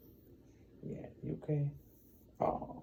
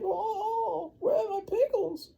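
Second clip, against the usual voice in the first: put-on high, crying old lady wail; mock-weepy grandma falsetto; 5 dB louder, slower than usual. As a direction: whine it like a heartbroken granny.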